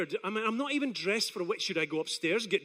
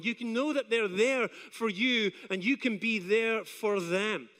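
usual speaking voice

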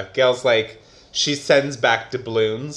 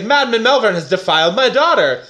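outraged father; 7 semitones higher, 5 dB louder than usual. Retold or imitated. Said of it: "So he's like".

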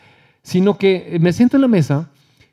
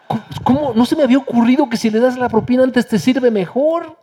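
mock amazement, higher, with a laugh; amazed; 8 semitones higher than usual. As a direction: sound delighted at the discovery. Doctor amazed, retold with laugh. (asombro fingido, más agudo, riendo)

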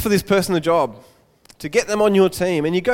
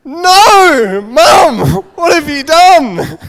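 high, much louder mock-despairing cry; hammed-up anguished wail; 11 semitones higher, 12 dB louder, slower than usual. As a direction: wail at mum as if the world ended. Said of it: "the son's kind of like".

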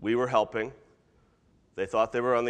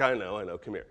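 soft, lower comforting voice; soothing; 5 semitones lower, 6 dB quieter, faster than usual. Acted. God to someone who cried out, tender soothing. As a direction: take them in gently, dropping the voice. God consoling the one crying out.

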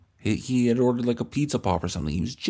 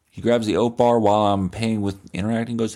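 ordinary talk, nothing marked

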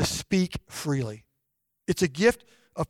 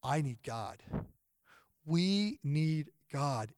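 hushed plea; 2 semitones lower, 9 dB quieter, slower than usual. Retold or imitated. Desperate plea, very soft slow.

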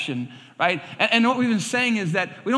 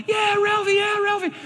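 put-on high kids' cheer; cheering kids; 13 semitones higher than usual. Neighborhood kids cheering Ralphie on in the fight, high excited cheer. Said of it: "kids are going".

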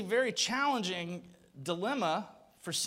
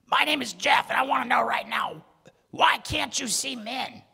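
louder, forced hoarse-whisper demand; strained demand; 3 semitones higher, 6 dB louder than usual. Retold or imitated. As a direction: insist through a lost voice. Demanding on the phone.

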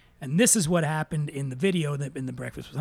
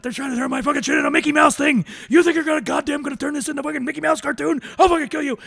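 freaking-out rant, high, loud and fast; Hunter; 10 semitones higher, 5 dB louder, faster than usual. Retold or imitated. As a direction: imitate an outraged writer exploding in fury. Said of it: "he starts freaking out about".

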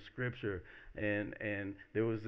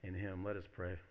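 ordinary delivery

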